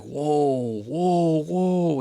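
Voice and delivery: mounting amazement, re-enacted; getting pumped. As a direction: build excitement step by step in pitch. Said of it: "I was just getting pumped".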